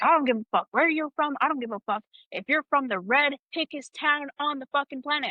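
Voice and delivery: high, mock-furious superior; exasperated dressing-down, acted out; imitated going off. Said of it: "he's just going off".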